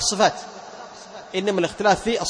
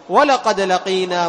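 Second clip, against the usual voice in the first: slow, louder chanted words; Quran recitation; 2 semitones higher, 7 dB louder, much slower than usual. Shown as a demonstration. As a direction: intone like a reciter. Recites verse example. (تلاوة مجودة)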